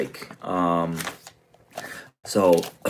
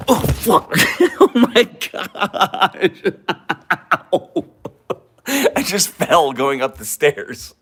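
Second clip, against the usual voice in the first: laughing; 10 semitones higher, 4 dB louder, much slower than usual. Real, not acted. Just fell on the stairs.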